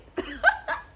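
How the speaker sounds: very high gleeful shriek; squealing laugh. Real, not acted. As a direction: let out a high delighted squeal. Joyful graduation announcement.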